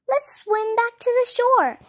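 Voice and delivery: high, loud, pressing; rally the duck to jump; urgent call. A narrator's reading. Dodsworth to the duck on the boat, urgent.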